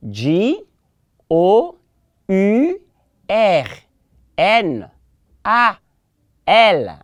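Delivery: J O U R N A L in French letter names, well spaced; spelled out. Shown as a demonstration. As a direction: dictate "J O U R N A L" one letter at a time. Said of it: "Let's take it again".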